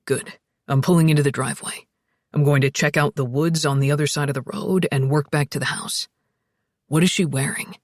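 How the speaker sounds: low, steady man's voice; deep, businesslike delivery; practical Alex. A narrator's reading. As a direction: take charge in a deep voice.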